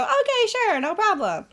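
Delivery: high-pitched, carefree yes; easygoing sing-out of acceptance; breezy agreement. Acted. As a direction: agree airily, voice lifted high. Acts out her breezy acceptance.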